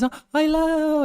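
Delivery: very high, slow, drawn-out hello; greeting grandma. Retold or imitated. All the grandmas greeting the visitor, high friendly imitation. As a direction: imitate a delighted granny's greeting. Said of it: "All the grandmas".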